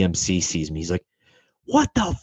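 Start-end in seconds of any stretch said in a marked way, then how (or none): 1.7-2.2 hammed-up enraged boss, shrill fury; mock chief yelling.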